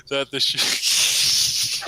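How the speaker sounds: breaks into high laughter mid-word; cracking up while reading; laughing. Real, not acted.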